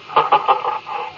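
a fond little laugh at a memory; quiet nostalgic chuckle; soft breathy laugh. Acted. Lester reminiscing, drifting in space.

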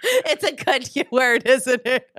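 high, giggly delivery; amused, laughter breaking into the words; laughing through words. Real, not acted. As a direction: try to talk while cracking up. Cracking up during prank call.

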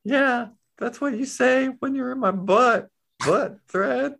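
high, taunting cartoon voice; high-pitched talking needle.

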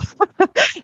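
quick amused laugh, high; a bright burst of laughter mid-thought; short laugh. Real, not acted.

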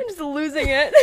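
high, loud, dissolving into laughter; losing it laughing. Real, not acted. Recalling herself losing it at security, cracking up. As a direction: laugh right through the words.

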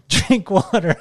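can't keep a straight face; laughing through it. Real, not acted. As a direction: quote breaks into a real laugh. Laughing while quoting dad.

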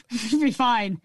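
normal talking voice

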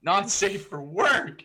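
squealing not safe for work; high shocked squeal. Real, not acted. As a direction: shriek it in shocked delight. Shocked reaction to crude joke.

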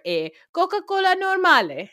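Italian accent, hammed-up; mock Italian accent. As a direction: order it with a hammy Italian accent. Mocking an order abroad.

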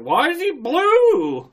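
high, loud, drawn-out question; imitating alarmed onlookers. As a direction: wail it slowly in mock astonishment.

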